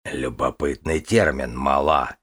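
slow, low musing dragon voice; thoughtful dragon. Acted. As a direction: muse over the word slowly. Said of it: "сказал задумчиво Казимир".

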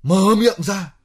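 high, loud angry complaint; exasperated outburst. Acted. Man fed up with partner's talk of money, exasperated. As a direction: throw it at them, pitch jumping right up. Snaps back in money quarrel.

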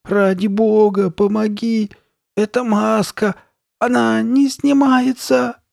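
high, drawn-out desperate wail; slow, panicky high-pitched begging; thin frightened voice. A narrator's reading. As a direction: plead in a scared little voice, stretching the words. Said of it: "тонким испуганным голоском".

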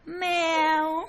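high, cat-like mew of the word; woman mewing at her meal; meowing like a cat. Retold or imitated. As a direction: say it as a cat's meow. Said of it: "ăn meo meo như mà dòng mèo vậy".

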